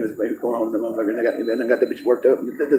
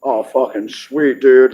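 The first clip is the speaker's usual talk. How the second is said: slow, dazed dude voice; slowed stoner impression; much slower than usual. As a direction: stretch it out like a stoned surfer. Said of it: "so it's like".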